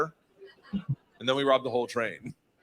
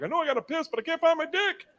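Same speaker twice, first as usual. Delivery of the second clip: put-on drunk voice; 13 semitones higher than usual. Drunk inner voice quoted.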